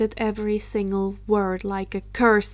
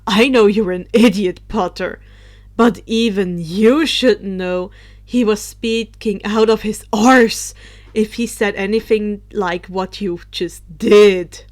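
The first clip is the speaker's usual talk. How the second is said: scornful; 8 dB louder than usual. Acted. Drake scolds Potter.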